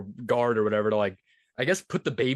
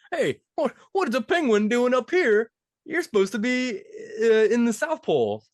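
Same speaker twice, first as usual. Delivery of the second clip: high, lightly loud jolly Santa; 11 semitones higher, 3 dB louder than usual. Retold or imitated. Santa finding a lost penguin, surprised and high. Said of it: "And he's like".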